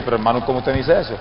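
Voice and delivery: low, soft, quick startled question; taken aback. Retold or imitated. Accused preacher questioning the evangelist, subdued fright. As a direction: question him timidly, in a retold hush. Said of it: "Yo me asusté y dije".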